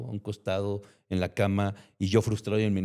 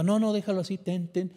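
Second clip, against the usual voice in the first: mock kindly customer; 9 semitones higher than usual. Customers giving him money.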